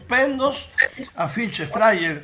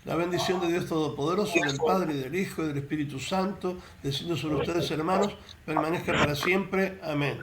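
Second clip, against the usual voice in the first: priestly blessing; 3 semitones lower, 6 dB quieter than usual. Acted.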